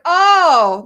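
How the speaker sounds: loud, high oh; a big, sudden realization; a coworker's caught-out "oh"; mock realization. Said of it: "they're like".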